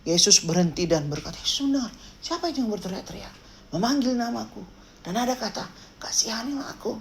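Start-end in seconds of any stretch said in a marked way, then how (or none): 1.4-5.7 soft, quiet acted aside; hushed musing. Acts Jesus overhearing the shouting. 6.0-7.0 soft whispered plea; whispered echo. Acted. Quiet echo of the distant cry.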